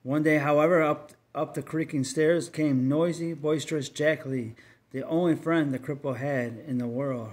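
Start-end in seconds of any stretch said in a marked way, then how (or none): none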